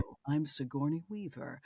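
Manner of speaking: lightly put-on actress voice; mock a self-important narrator slipping into impression; Sigourney Weaver. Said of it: "All of a sudden, I'm like".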